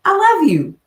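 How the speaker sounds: high, light mock-sweet husband; lightly voiced loving husband. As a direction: sing it out like a doting husband. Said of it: "And he's like".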